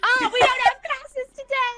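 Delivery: giggly fake-casual excuse; laughing cover-up. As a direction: laugh it out, playing along.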